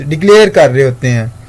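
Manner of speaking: ordinary talk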